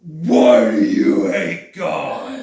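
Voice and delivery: slow, loud dramatic demand; hammed-up shout. [name] confronting Kevin Sorbo in debate, hammed-up shouting. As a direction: drag out an overblown accusing yell.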